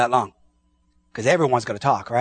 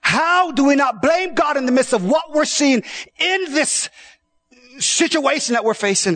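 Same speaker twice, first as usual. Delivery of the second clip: high, loud preaching cry; preaching at full force; 14 semitones higher, 3 dB louder than usual. Acted. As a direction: cry it out over the crowd. Preacher shouting.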